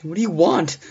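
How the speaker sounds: very high, loud, frantic question; desperate demand. Acted. Babysitter demanding what the caller wants, frantic. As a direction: cry it out in desperation.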